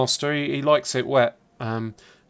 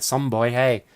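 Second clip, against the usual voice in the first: bad Northern Irish; slower than usual. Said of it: "a very bad Northern Irish accent".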